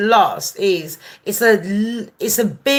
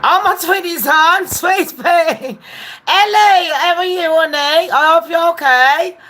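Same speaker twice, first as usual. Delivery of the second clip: gushing greeting; 8 semitones higher than usual. Real, not acted.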